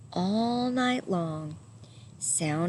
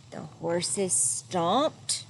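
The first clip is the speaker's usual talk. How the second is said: plain reading voice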